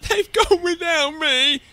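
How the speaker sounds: high, loud, drawn-out wail; theatrical childlike lament; distressed cry. A narrator's reading. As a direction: wail it as the one left behind. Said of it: "And he cried".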